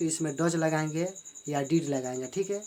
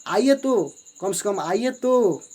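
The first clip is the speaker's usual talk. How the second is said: higher and louder, then slow insistence; emphatic invitation; 4 semitones higher, 7 dB louder, slower than usual. Shown as a demonstration. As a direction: coax them warmly but firmly to come. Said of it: "तो आप बोलते हैं ना". (ऊँची, ज़ोरदार आवाज़ में आग्रह)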